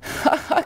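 airy unvoiced burst of laughter; breathy laugh. A narrator's reading. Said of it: "Maxence était hilar".

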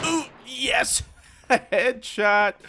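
loud, high cheer with a laugh; cheering his own headshot; triumphant shout. Real, not acted. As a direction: celebrate the kill out loud. Scoring headshot.

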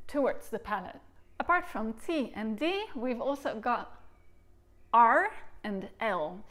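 normal talking voice

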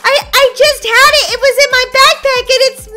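high, loud, stammered excuse; flustered panic. Acted. Daisy can't find her homework for the teacher, flustered panic. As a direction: panic and rush to explain. Student finds homework missing.

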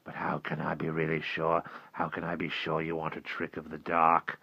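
put-on hushed, fearful old man's plea; a scared old walker whispering his doubt; frightened old man. Old walker pleading with Will, hushed and afraid.

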